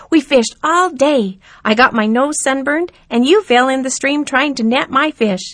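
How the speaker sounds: put-on boy hoping grandpa remembers; pleading young boy. A narrator's reading. Jeremy voiced in story.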